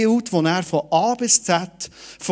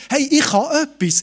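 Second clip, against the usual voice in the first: put-on proud teen; 8 semitones higher than usual. Teen boasting in retold thought.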